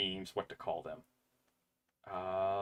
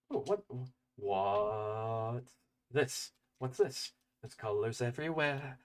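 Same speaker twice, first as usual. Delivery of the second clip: put-on childlike wonder; 4 dB quieter than usual. Wonder at the snow.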